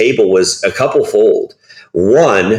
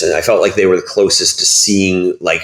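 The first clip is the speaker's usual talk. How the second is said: ordinary talk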